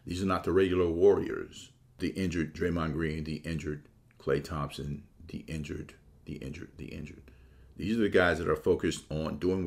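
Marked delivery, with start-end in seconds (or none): 2.0-7.3 each the injured softer and lower, dying away; a mock-solemn roll call that fades out; fading dramatic repeat. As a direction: let the list fade to almost nothing. Dramatic list of injured players.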